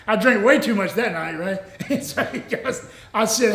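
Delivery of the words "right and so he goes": ordinary talk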